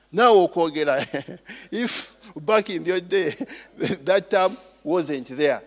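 usual speaking voice

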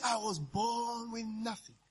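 almost hush it, humbly. Retold or imitated. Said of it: "She said".

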